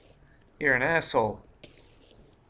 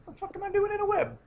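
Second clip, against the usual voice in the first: shocked squeal; 3 dB quieter, faster than usual. Real, not acted. Startled gamer caught in web.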